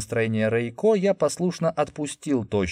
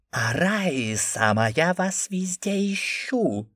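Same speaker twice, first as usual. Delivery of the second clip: slow, high eldritch voice; inhuman voice; 8 semitones higher, slower than usual. A narrator's reading. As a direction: speak in an inhuman voice. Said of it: "нечеловеческим голосом".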